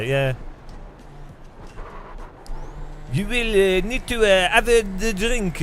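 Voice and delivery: lightly hammed French accent; mock French accent. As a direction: fumble the words as a caricature Frenchman. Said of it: "The French are like".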